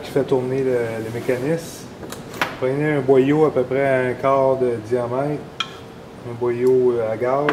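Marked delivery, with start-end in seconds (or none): none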